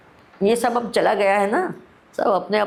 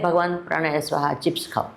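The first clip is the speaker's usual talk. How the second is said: lower, softer ritual mumble; mock food-offering prayer; 6 semitones lower, 4 dB quieter, faster than usual. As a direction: pretend to bless junk food in a low prayer voice. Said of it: "हम chips लेके कहते हैं".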